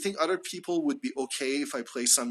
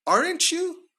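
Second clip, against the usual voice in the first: "Aren't you?" falling on "Aren't", jumping much higher on "you"; rising tag; 13 semitones higher than usual. Shown as a demonstration. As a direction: pitch "Aren't you?" high, climbing on "you". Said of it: "Pay attention to how I say it".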